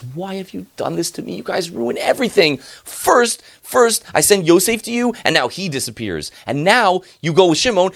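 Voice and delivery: high, loud, anguished outcry; furious father. Acted. Yaakov furious at his sons for losing his children. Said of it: "Yaakov gets furious and says".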